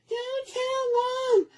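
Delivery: put-on high, slow begging kid voice, drawn out; pleading little brother. Bleeding brother begging his sister not to tell, high and slow.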